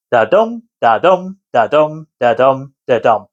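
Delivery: "Da-dum" with the beat on dum, five times; steady heartbeat "da-dum", falling at the end; heartbeat rhythm. Shown as a demonstration. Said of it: "five heartbeats".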